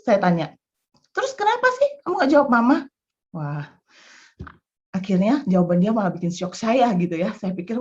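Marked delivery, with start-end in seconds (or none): 1.1-2.8 higher, louder, fast demand; mother cornering the child; pressing scold. Retold or imitated. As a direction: push hard for an answer.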